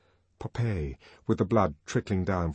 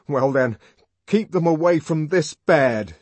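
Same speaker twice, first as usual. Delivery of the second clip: loud, higher indignant retort; shaking with indignation; 7 semitones higher, 10 dB louder than usual. A narrator's reading. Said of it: "voice was trembling with indignation".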